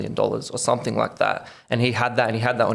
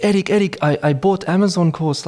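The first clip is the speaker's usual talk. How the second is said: put-on thick European accent; 7 semitones higher than usual. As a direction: mimic mum calling him in a thick European accent. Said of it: "in a thick European accent".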